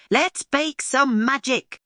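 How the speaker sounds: high, slow and loud, full of wonder; excited. A narrator's reading. Lulu to her stardust, thrilled and loud. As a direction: cry it out like a spell with delight. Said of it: "my little cosmic sprinkles".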